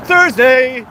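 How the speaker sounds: loud, high burst of remembering; sudden realisation. Real, not acted. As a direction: blurt out the answer you just found.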